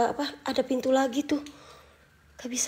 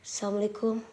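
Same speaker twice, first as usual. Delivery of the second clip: ordinary talk, nothing marked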